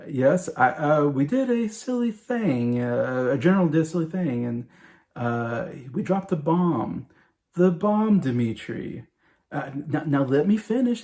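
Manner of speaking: halting, high-lifted imitation of a sheepish leader; President Merkin. President phoning the Russian leader about the bomb, imitated awkward confession. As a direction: slowly break bad news, voice lifting on key words. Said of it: "the way he has to approach that".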